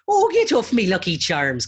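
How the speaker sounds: loud, high cartoon Irish brogue; clichéd Irish accent. Mimicking Americans' stock Irish accent, loud mock Irish. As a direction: belt out a hammed-up fake Irish accent. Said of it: "a Irish accent always does the".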